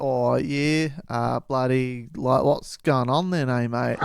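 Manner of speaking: broad Australian twang, drawn out on bloody; Australian accent. Retold or imitated. Ian greeting the travellers in a broad Australian accent. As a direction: lay the Aussie accent on like a bloke down the pub. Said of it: "my Australian accent was no good".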